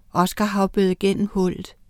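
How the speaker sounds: usual speaking voice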